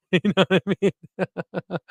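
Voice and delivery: real laughter breaking up the words; laughing. Real, not acted. Joking to viewers about lack of sleep, laughing. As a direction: laugh helplessly as you talk. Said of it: "I'm laughing, but I'm crying inside".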